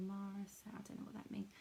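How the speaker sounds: hushed reading of a comment; whispered to herself. Real, not acted. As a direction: mutter it under your breath as you read.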